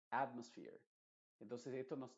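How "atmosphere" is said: atmosphere, AT first in weight, sphere second; stress on AT. Shown as a demonstration. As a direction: demonstrate atmosphere leaning on AT. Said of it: "también importancia, pero en segunda instancia". (atmosphere con AT fuerte y sphere secundario)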